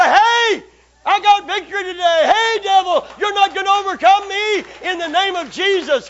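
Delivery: loud, high victory shout; a war cry of faith, shouting; triumphant shout. Acted. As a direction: cry out against the devil. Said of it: "you can rue-ah like that".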